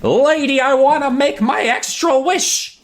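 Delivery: put-on high, loud shout of a kid calling out; yelling. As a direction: yell after the lady across the road. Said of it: "Sam yells to her".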